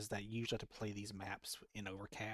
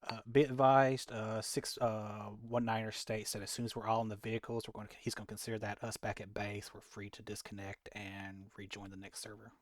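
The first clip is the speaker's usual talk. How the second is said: loud radio relay, then trailing off; radio call voice; 4 dB louder than usual. Acted. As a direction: relay the order over comms.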